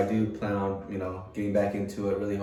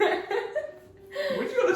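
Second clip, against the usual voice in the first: slow, high, laughing jab; laughing tease; much slower than usual. Real, not acted. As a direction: tease her while cracking up. Teasing her through laughter.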